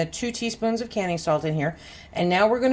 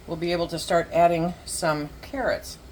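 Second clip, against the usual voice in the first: normal talking voice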